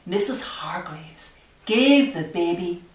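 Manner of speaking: ordinary delivery, nothing marked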